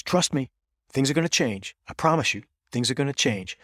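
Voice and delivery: earnest promise, voice lifted high; retold earnest pleading. Coach urging his players to trust him, retold pleading.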